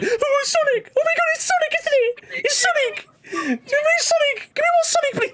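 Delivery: very high, squealing fanboy imitation; mocking excited fan. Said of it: "You were just like".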